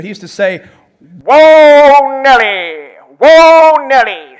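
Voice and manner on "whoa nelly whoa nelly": booming announcer call, higher and much louder on whoa; hammed-up sportscaster exclamation; Keith Jackson impression. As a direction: stretch out whoa like a big game call. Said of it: "Let's see if I can do it".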